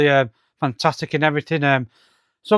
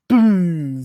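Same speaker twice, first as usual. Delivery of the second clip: drawn-out "boom" trailing into a rumble; imitated explosion. Reviewer voicing the comic's explosion, imitated boom. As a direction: mimic a comic-book blast.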